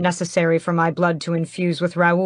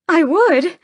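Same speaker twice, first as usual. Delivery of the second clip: high, loud scandalized protest; 13 semitones higher, 8 dB louder than usual. A narrator's reading. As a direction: sound deeply offended. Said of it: "sounding scandalized".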